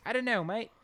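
a joking Aussie mate reply; Australian accent. Pretend. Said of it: "good Australian accent".

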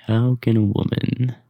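announcer voice. Pretend. Echoing question as announcer.

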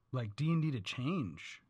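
ordinary talk

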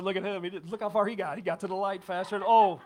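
amused jeer at the highway racer; mocking laugh. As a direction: tease the racing driver through laughter. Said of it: "we always laugh at him now, going".

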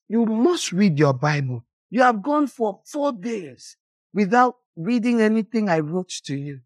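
ordinary delivery

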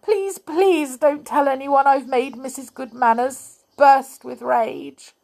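high, tearful storybook pleading; sobbing. A narrator's reading. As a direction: start in a wail, settle into begging. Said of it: "sobbed Amelia".

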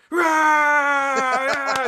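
loud, high cheering roar; crowd roar. Acted. The crowd cheering as the sword display finishes, roaring.

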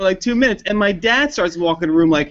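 normal talking voice

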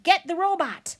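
loud, high growled command; growling. A narrator's reading. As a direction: order the cubs to attack. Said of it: "Mother Bear growled".

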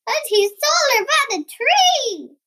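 high-pitched panicky cry; alarmed warning. A narrator's reading. Frightened Elephant warns Mummy Frog about the giant, high alarmed wail. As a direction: warn her high and urgently.

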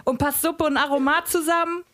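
interrogating shout; chef yelling. A narrator's reading. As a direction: snap the question.

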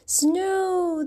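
high, thrilled call of snow; excited shout. Acted. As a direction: cry it out in wonder. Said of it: "they shouted".

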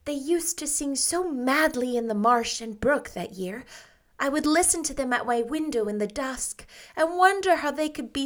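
put-on bright, breathless girlish rapture; Anne's voice. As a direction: gush like a dreamy, happy girl. Anne voiced, girlish, higher.

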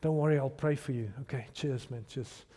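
low, soft, fading throwaway goodbye; casual brush-off. Pretend. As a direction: toss it off quietly in passing. Imagined Jesus casually brushing off blind man.

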